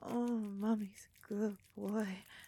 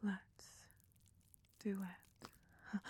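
ordinary delivery

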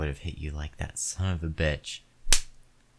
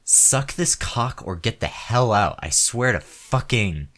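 loud, raging tirade, higher and louder; enraged man yelling at the woman who stopped; furious shouting; 3 semitones higher, 6 dB louder than usual. Acted. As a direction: scream it at her in full fury. Escalating furious rant at partner.

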